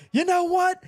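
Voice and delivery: imitating an angry man losing it, high and loud; mock angry outburst. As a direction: play the pissed-off guy yelling in a squeaky voice. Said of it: "he gets pissed off".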